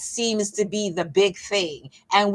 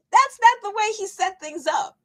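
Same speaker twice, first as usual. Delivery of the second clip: much higher-pitched insistence; high-pitched protest; 11 semitones higher than usual. Real, not acted. Exasperated rebuttal to blaming God.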